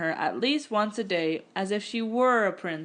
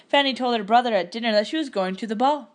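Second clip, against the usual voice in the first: ordinary delivery, nothing marked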